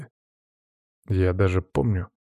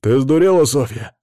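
louder, angry rebuke; loud growling scold; growled; 4 semitones higher, 6 dB louder than usual. A narrator's reading. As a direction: bark her back into line. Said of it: "зарычал на неё Иван".

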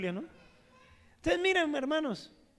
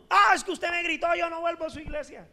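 higher, a whiny mock complaint; mock offended churchgoer; 5 semitones higher, faster than usual. Imitating a churchgoer scolded by the pastor, whiny. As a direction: play the offended member who quits.

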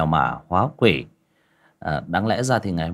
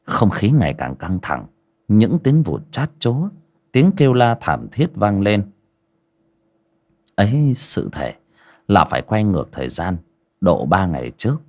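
horror story narration; 5 dB louder than usual. A narrator's reading. Storyteller opening a village ghost tale, grave narration. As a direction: draw listeners into the eerie night.

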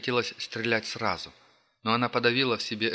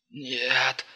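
hoarse; 4 semitones higher than usual. Acted. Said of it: "прохрипел бандит".

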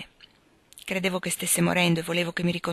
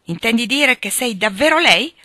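loud, higher incredulous question; alarmed and rising in pitch; shocked disbelief; 5 semitones higher, 8 dB louder than usual. Acted. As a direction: demand confirmation, aghast. Sick man shocked by the truth.

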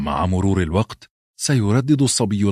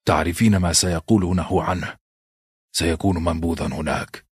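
understated hurt, slightly lowered voice; subdued, aching narrated husband; pained; 2 semitones lower than usual. A narrator's reading. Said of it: "النظرة التي رمقها نيد بها شديدة الألم".

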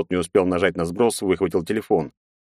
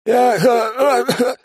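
choking panic; 5 dB louder, much slower than usual. A narrator's reading. Strangled prisoner croaks.

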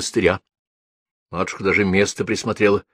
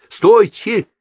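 sudden high call to stop her; exclaiming; 13 semitones higher, 6 dB louder than usual. Acted. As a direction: cry out to halt her mid-step. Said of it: "воскликнул Арсений Васильевич".

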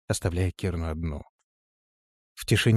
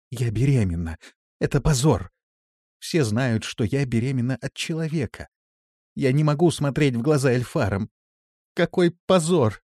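raised, distressed lament; pained despair; 5 semitones higher, 4 dB louder than usual. A narrator's reading. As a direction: build to a high cry of shame. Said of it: "голос дрожал от боли и отчаяния". (с болью и отчаянием)

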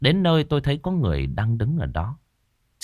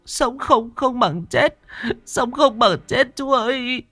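high, tearful, stammering plea; old woman whimpering; 12 semitones higher than usual. Acted. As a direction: beg him not to report her, near tears. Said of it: "mụ Vi vẫn cứ mếu máo". (giọng bà già mếu máo van xin)

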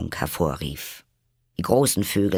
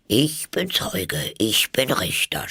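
sly dog in slow, measured verse; schlau; 6 semitones higher, slower than usual. A narrator's reading. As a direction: announce it craftily, drawing out the words. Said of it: "sprach er schlau". (listig, gedehnt)

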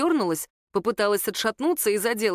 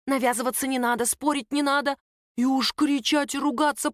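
understated scolding, voiced rather than whispered, pitch lifting; angry whisper; 5 semitones higher than usual. A narrator's reading. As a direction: nag a son with mild exasperation. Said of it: "сердитым шёпотом".

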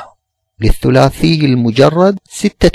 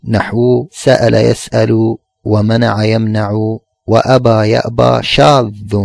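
a steady recital of the classical text, pitched a little lower; reading the text aloud; 3 semitones lower than usual. Acted. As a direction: read the author's words out evenly, like quoting a book. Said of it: "قال المصنف".

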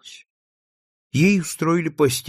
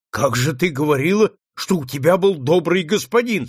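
louder, rising outcry of disbelief; indignant exclamation; 5 semitones higher, 5 dB louder than usual. A narrator's reading. Senator reacts to the runaway's story, indignant outburst. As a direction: burst out at the injustice, voice climbing. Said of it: "вскричал сенатор".

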